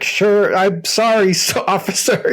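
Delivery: hammed-up American, laughing through it; fake American accent. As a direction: badly fake an American accent to fool a cop. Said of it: "trying to fake it the hardest".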